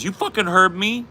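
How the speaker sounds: high, loud mock-angry drunk; hammed-up drunk friend spoiling for a fight; imitating belligerent drunk. He's drunk.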